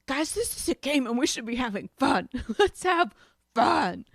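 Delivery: pleading cheerfully. Retold or imitated. Pleading with arguing board game players.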